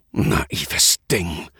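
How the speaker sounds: a hint of a contemptuous growl. A narrator's reading. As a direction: growl it down at her. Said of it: "knurrte Keno".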